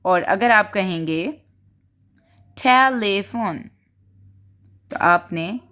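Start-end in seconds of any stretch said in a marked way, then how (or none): 2.6-3.7 "telephone" slowly in three beats, te-lee-fone; syllable by syllable. Shown as a demonstration. Teacher showing teachers the syllables, "telephone" said slowly in beats. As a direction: sound out "telephone", each syllable distinct. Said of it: "तीन possess दिए हैं".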